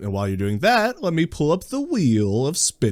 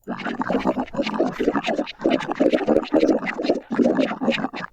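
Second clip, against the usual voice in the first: breathless laughing fit; 12 semitones lower, 4 dB quieter than usual. Real, not acted. Laughing at the quiz options.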